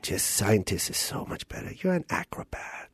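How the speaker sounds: barely voiced, hushed dad; very soft, half-whispered fatherly verdict. Dad disapproving of son's acting, nearly whispered. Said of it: "I'm gonna play your dad".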